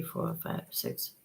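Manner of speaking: counting to herself in a whisper. Real, not acted. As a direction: whisper the count under your breath. Counting to herself while calculating.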